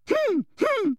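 very high, dainty "hem, hem"; squeaky attention-seeking hum; affected little throat-clearing. A narrator's reading. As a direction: cut in with a prim fake cough. Umbridge interrupts with fake cough.

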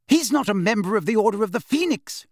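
high, heated protest; indignant objection. A narrator's reading. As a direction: object sharply, pitched well up. Quarrel over Harry at table.

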